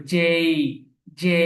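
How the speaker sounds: জে gliding up into i, "jey"; lifted ending. Shown as a demonstration. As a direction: lift the end of জে into a glide. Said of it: "একটু উপরে উঠবে".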